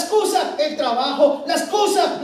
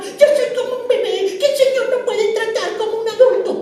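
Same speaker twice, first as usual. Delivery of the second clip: put-on high, laughing babyish voice, higher; mock baby voice; 9 semitones higher than usual. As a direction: whine like a spiritual baby, laughing. Mocking himself as a baby. (el predicador se burla de sí mismo con voz aguda de bebé)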